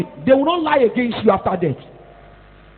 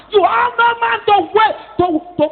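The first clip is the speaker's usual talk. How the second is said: preaching shout; 7 semitones higher, 4 dB louder than usual. Real, not acted. Preacher rebuking congregation.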